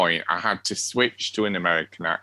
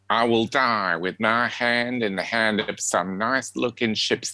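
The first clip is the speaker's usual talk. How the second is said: put-on Southern American accent. Podcaster showing co-host an American accent with Streetcar lines, Southern accent. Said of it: "that's my attempt to Southern accent".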